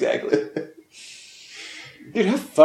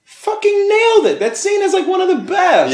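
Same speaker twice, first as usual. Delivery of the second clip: high, loud burst of praise; excited shout; 3 dB louder than usual. Real, not acted. Friend raving about the casting, shouting. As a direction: shout it out in delight. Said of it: "excited praise of the scene".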